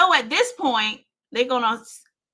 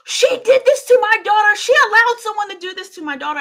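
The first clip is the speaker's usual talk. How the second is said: higher, louder furious accusing yell; angry accusation; 11 semitones higher, 9 dB louder than usual. Retold or imitated. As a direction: hurl the blame at her. Family accusing her at hospital.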